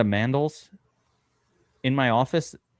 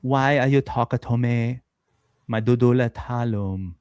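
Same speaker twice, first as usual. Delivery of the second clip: slow, drawn-out Persian accent; mock Persian accent at an unhurried pace; slower than usual. Shown as a demonstration. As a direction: put on a Persian accent, stretching the words. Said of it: "Persian sounds like this".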